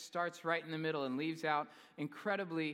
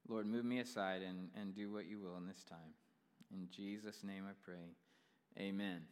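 prayer; 5 semitones lower than usual. Acted. Pastor leads congregation in prayer.

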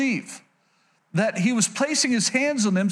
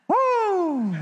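excited whoop; 11 semitones higher than usual. Real, not acted. Preacher excited by his point.

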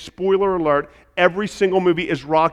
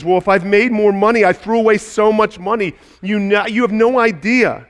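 despairing regret; 7 semitones higher, 9 dB louder than usual. Retold or imitated. Schindler despairing over money.

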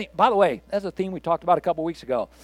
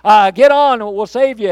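high, loud yell over a helicopter; 6 semitones higher, 9 dB louder than usual. Retold or imitated. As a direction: holler down from the helicopter. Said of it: "yells down".

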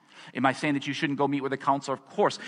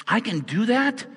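much higher, slower, amazed question; a touch of wide-eyed wonder; surprised disbelief; 9 semitones higher, 3 dB louder than usual. Retold or imitated. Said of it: "And you're going".

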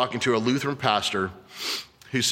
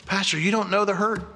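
high, pleading voice of a wounded churchgoer; hurt listener's objection; 9 semitones higher than usual. Pretend. Churchgoer protesting to the pastor, pleading high voice.

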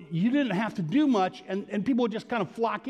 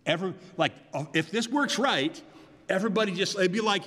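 ordinary talk

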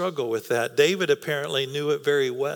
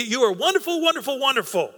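higher, each wonderful lifted and stressed; emphatic repetition; 7 semitones higher, faster than usual. Retold or imitated. Preacher voices God's emphatic praise, higher and stressed. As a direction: pile up the praise, voicing God delighting in you. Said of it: "he's emphasizing it".

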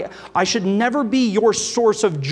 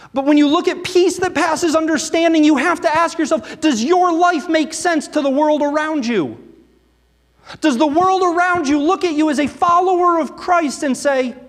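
higher, louder, building sermon drive; preaching at full force; 8 semitones higher, 4 dB louder than usual. Real, not acted. Pastor presses church members about peace that passes understanding, preaching hard. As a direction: press the congregation with rising intensity.

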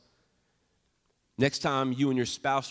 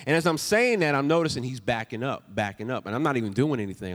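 ordinary delivery

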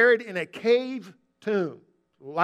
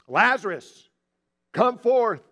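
loud, commanding summons; raised, ringing command; calling out; 3 semitones higher, 5 dB louder, slower than usual. Retold or imitated. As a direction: project the call into a tomb, then stop. Re-enacting Jesus' loud call.

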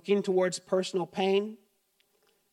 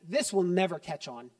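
ordinary talk

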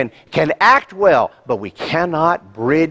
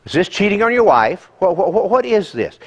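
normal talking voice

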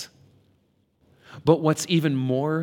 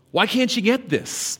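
higher, pleading exasperation; exasperated Jesus; 10 semitones higher, 4 dB louder than usual. Retold or imitated. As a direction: ask it like a fed-up teacher. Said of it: "Can you hear the exacerbation in Jesus' voice".

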